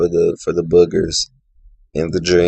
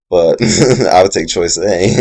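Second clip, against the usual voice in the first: louder, laughing through words; 3 semitones higher, 6 dB louder than usual. Real, not acted. Laughing at own joke.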